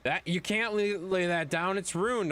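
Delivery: hammed-up fretting of a clueless client; mocking clueless customers. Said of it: "They're just like".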